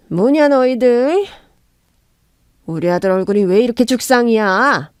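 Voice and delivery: lightly voiced suspicious mother-in-law; mother-in-law questioning the couple, lightly voiced.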